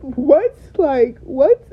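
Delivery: can't believe what she's hearing; shocked disbelief. Retold or imitated. Retelling her reaction to his answer, shocked and loud. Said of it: "I said".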